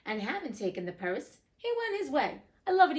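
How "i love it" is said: higher, louder delighted exclamation. Real, not acted. Reader delighted by the fiddler's trick, loud and high. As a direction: burst out with real glee. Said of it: "reader delights in the story".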